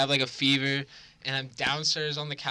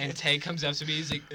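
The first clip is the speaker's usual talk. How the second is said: usual speaking voice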